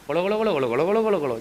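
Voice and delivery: low, soft babbling mimic of chattering kids; hushed gibberish murmur of youths ignoring the speaker; imitated crowd chatter. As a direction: mumble it like a noisy crowd that won't stop. Youth chattering through his talk.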